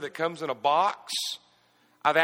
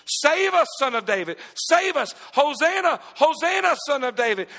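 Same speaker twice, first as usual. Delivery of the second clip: crowd shout; 6 semitones higher, 4 dB louder than usual. Retold or imitated. Crowd shouting at Jesus's entry.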